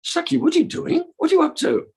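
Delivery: put-on very high, startled girl's voice; high-pitched, scandalised questioning; Lalita. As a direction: challenge your friend in a squeaky high pitch. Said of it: "And Lalita's saying".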